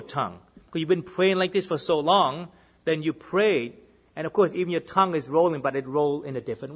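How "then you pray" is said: normal talking voice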